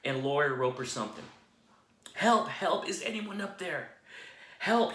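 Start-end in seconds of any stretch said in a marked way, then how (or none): 2.1-4.9 high, loud cries for help; stranded man shouting up for rescue; yelling for help. Retold or imitated. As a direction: call up from the cliff, desperate. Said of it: "Jack began yelling for help".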